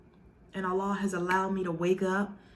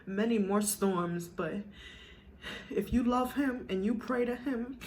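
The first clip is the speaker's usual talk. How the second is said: ordinary delivery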